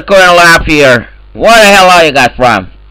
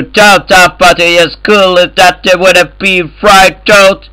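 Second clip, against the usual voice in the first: usual speaking voice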